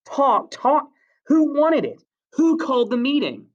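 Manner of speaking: very high-pitched exasperated shouting; angry shout. A narrator's reading. Ralph challenges Jack over the meeting, shouting high. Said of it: "shouted Ralph".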